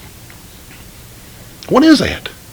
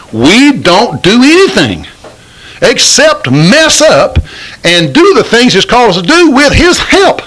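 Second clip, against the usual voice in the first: rising preaching fervor; 9 semitones higher than usual. Real, not acted. Preacher builds to climax.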